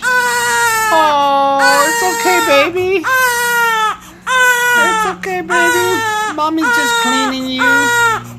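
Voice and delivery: very high, loud soothing coos; soothing a scared dog. Real, not acted. Mom calms crying baby in diaper change.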